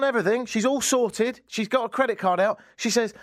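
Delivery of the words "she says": ordinary talk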